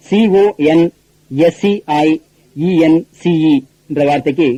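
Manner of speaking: normal talking voice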